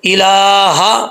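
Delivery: الہ with the ha kept short, not drawn out; short ha in ilaha. Shown as a demonstration. Teacher showing a student الہ with a short ha. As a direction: say الہ slowly without stretching the ha. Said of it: "ہا کو لمبا نہیں کرنا".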